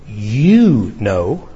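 weighty, emphatic YOU; stress on YOU. Acted. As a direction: lean slowly into "you", then pause. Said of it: "The you is in the emphatic position".

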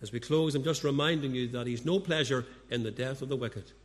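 usual speaking voice

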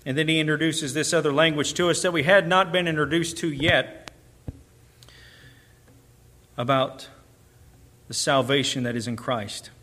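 usual speaking voice

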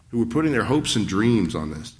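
ordinary talk

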